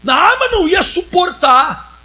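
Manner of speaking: a hint of an offended husband's outburst; indignant protest. Man reacting to the question.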